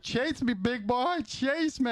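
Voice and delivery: very high, playful camp squeal; camp character. Retold or imitated. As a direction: squeak it out like a flamboyant crook on the run. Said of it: "He acted really camp".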